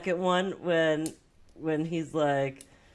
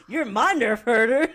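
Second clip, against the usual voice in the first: laughing; 6 semitones higher, 6 dB louder than usual. Retold or imitated. Peter's line, laughing.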